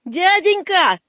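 put-on high boy's call. Васька calls back old man, calling out. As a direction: call after him in a pleading high voice. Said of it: "крикнул Васька".

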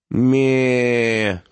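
long, drawn-out mock bleat; mocking mimicry. A narrator's reading. Doctor teasing a boy who began crying, mocking mimicry. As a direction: mimic a crybaby with a stretched bleat. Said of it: "передразнил доктор".

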